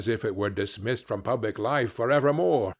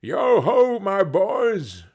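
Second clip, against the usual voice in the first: hearty call, higher and louder; jovial Fezziwig; 6 semitones higher, 5 dB louder, slower than usual. Acted. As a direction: hail the apprentices like a merry old master. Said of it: "said Fezziwig".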